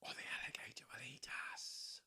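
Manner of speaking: soft, whispered hiss; hissing snake. A narrator's reading. Snake warning the others in a whispered hiss. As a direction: breathe it out like a snake. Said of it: "hissed the snake".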